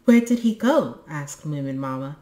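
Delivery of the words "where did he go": high, loud, eager asking; urgent question. A narrator's reading. Moominmama asks the boy where her husband went, urgent question. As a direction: demand news with real eagerness.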